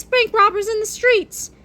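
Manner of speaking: high, agitated reading of a dislike. A narrator's reading. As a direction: say it high, as a pet hate. Same words listed as dislikes.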